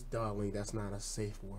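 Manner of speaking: soft, low retold reprimand; murmured in the ear. Dominant at a sub's ear, softly correcting. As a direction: imitate a dominant's quiet word in the ear. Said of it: "she comes to my ear and says".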